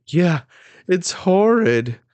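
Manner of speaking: high, loud, drawn-out horror; acting out a horrified mother; freaking out. Queen about her newborn's face, freaking out. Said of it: "she, like, freaks out".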